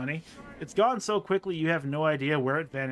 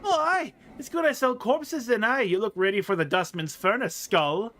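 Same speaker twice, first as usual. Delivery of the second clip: put-on prickly tailed girl; 6 semitones higher, 4 dB louder than usual. Girl trading insults with a skull, prickly. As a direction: throw the insult back in an accent.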